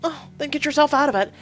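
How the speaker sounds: high, loud nagging mother voice; a shrill-pitched mother demanding answers; scolding mother. Acted. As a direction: lay into your grown daughter from up high.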